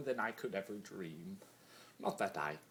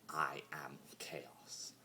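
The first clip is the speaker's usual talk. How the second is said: slow whispered "I am chaos", barely voiced; 7 semitones lower, 7 dB quieter, much slower than usual. Discord quietly admitting what he is, slow whisper. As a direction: let it fall empty in a whisper. Repeats the declaration hollowly.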